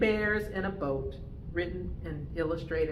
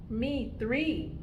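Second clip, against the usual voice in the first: little bear cub; 6 semitones higher than usual. Acted. Cub Charlie joins the apology.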